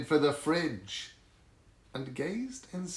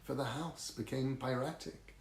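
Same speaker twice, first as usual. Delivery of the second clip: ordinary delivery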